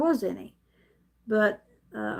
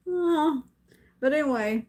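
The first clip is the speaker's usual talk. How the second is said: normal talking voice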